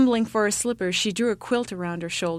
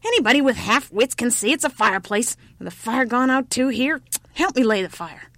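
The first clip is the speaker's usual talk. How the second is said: put-on raised, impatient old-woman scolding; old woman crying out; 5 semitones higher, 4 dB louder than usual. A narrator's reading. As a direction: snap at a clueless child. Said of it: "cried the old woman".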